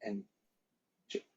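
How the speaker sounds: ordinary delivery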